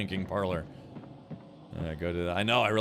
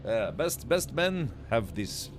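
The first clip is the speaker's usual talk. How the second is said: mock Eastern European foreigner accent; fake Eastern European accent; 2 semitones higher than usual. Foreigner explaining his mustache customs, fake Eastern European accent. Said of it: "What kind of mustache is that".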